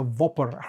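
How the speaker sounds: "Whopper" said "Vopper", V in place of the W. Shown as a demonstration. Streamer joking about the shop's burger name, "Whopper" with a V. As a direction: swap the W of "Whopper" for a V. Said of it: "instead of a Whopper".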